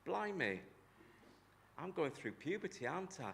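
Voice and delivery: high, loud, giggling amazement; amazed realisation. Retold or imitated. Boy realising he is going through puberty, high laughing amazement. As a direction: blurt it out, half laughing. Boy's inner reaction.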